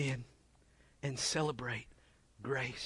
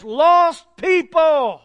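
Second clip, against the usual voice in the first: slow, louder, higher, each word weighted; emphatic slow; 9 semitones higher, 9 dB louder, much slower than usual. Shown as a demonstration. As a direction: spell out the line item with emphasis. Imagined budget line item.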